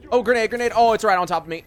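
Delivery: loud, high alarmed yell; grenade warning yell. Real, not acted. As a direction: shout the warning in panic. Grenade lands near him.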